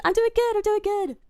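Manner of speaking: high-pitched, perky repeat; excited squeal. Real, not acted. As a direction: squeak it out with glee. Squealed reply to a viewer.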